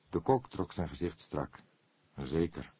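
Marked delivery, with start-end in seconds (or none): none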